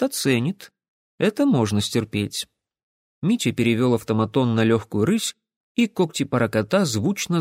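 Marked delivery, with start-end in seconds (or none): none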